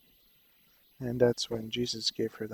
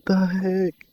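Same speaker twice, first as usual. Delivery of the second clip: higher and louder, worked-up amazement; excited disbelief; 4 semitones higher, 8 dB louder than usual. Retold or imitated. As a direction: ride the wave of wonder. Retelling his shock at anger vanishing.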